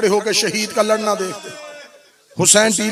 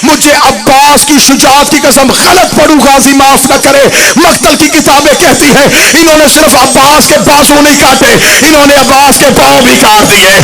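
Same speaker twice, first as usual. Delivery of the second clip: loud, high, impassioned recital; full-force preaching; 4 semitones higher, 12 dB louder than usual. Acted. Preacher in Northampton urging mourners to matam, impassioned. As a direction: build to a crying peak.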